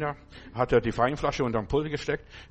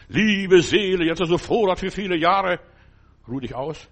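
loud, higher, boastful voice of the rich fool; self-satisfied rich man; 4 semitones higher, 6 dB louder than usual. Retold or imitated. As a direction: gloat over your full barns. Rich man speaks to his soul.

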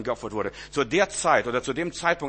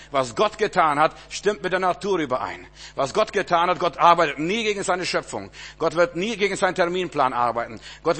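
rising preaching; 4 semitones higher, 5 dB louder than usual. Acted. Preaching builds.

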